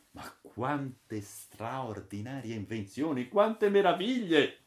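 slow, rising in wonder to a high cry; amazed exclamation. Acted. Toby marveling at the inventions, slow and rising high. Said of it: "esclamò Toby".

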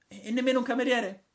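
high, fast, stammering disbelief; astonished. Acted. Braddock stunned to hear there are no waiters, high and fast. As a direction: jump up in pitch, amazed.

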